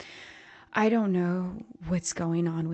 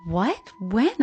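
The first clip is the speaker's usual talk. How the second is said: shocked question; 3 semitones higher, 9 dB louder than usual. A narrator's reading. Daughter shocked by news.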